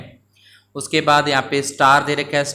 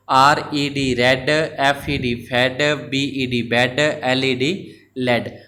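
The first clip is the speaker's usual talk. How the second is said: slow spelling out; slower than usual. Shown as a demonstration. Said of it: "fifth number के अंदर".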